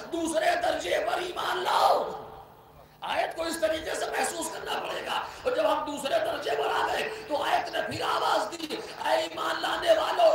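loud, rising pulpit voice that keeps pressing; forceful preaching. Acted. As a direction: urge them on, step after step.

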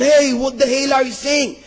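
high-pitched mock outrage; angry ruler. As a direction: act out a ruler losing his temper. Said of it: "this ruler was getting angry".

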